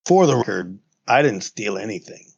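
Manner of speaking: normal talking voice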